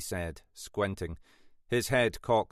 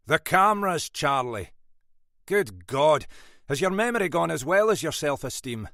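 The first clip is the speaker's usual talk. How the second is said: loud, raised-pitch exasperated rebuke; 7 semitones higher, 5 dB louder than usual. A narrator's reading. Boss chiding sergeant over cameras, exasperated and loud. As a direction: berate a forgetful junior, punching it out.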